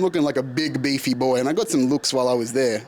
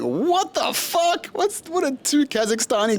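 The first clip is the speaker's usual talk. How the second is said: very high, drawn-out comic disbelief; incredulous outburst; 12 semitones higher than usual. Retold or imitated. Traveller learning the stranger is from Kazakhstan, incredulous outburst. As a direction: stretch it out slowly, pitch leaping way up. Acting own reaction to two Kazakhstanis.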